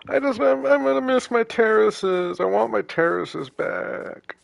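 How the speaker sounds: high, whiny crybaby wail. Pretend. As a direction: wail like a crying child. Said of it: "Do you guys like my crybaby voice".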